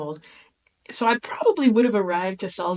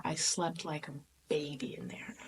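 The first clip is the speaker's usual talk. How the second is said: hushed, contented whisper; 4 semitones lower, 11 dB quieter than usual. Real, not acted. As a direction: breathe it out like a secret. Blissful memory of sleeping well.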